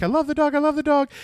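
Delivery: very high, loud child imitation; excited kids. Kids about their new dog, squealed delight.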